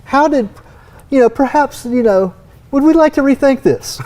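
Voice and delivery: lightly mock a refined gentleman; mock posh. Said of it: "my pinky up".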